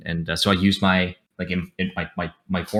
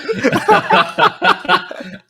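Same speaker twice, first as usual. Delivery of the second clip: loud, high burst of laughter; real laughter; 5 dB louder than usual. Real, not acted. Host cracking up at a friend's boast, loud laughter.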